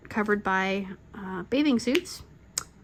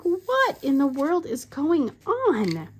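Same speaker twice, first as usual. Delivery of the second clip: drawn-out, higher-pitched exasperation; exasperated; 6 semitones higher, slower than usual. Retold or imitated. Wife retelling her reaction to another plumbing problem, exasperated. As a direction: stretch it out in disbelief.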